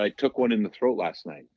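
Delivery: normal talking voice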